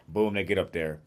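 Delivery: ordinary talk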